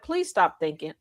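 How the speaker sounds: low, dead-serious quoted plea. Retold or imitated. Retelling a friend's plea, dead serious. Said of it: "She was so serious when she was like".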